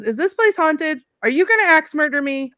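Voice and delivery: hammed-up suspicious alarm; mock alarm. Boy questioning his uncle's house, imitated alarm. Retelling frightened boy's accusing questions.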